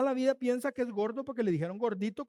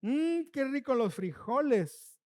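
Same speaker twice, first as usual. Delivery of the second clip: slow, higher, drawn-out mock delight; sarcastic praise; 4 semitones higher, slower than usual. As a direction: praise it slowly with obvious insincerity. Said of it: "el tono de la voz lo dice todo".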